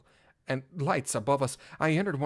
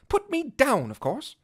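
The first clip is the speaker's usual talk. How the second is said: indignant; 11 semitones higher, 5 dB louder than usual. Acted. Indignant reply to police.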